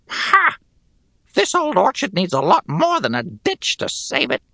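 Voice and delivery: high, grating goblin voice. Acted. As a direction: sneer it like a goblin soldier. Goblin scoffs.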